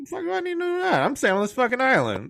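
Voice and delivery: exasperated Kong. Retold or imitated. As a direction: throw up your hands as Kong. Kong voiced, frustrated.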